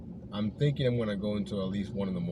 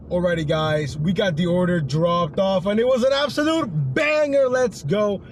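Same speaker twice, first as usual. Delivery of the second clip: thrilled shout of a big win; louder and higher, celebrating; excited, loud; 7 semitones higher, 6 dB louder than usual. Real, not acted.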